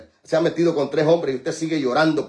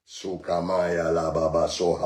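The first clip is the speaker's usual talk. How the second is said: speaking in tongues; 11 semitones lower, 6 dB quieter than usual. Real, not acted.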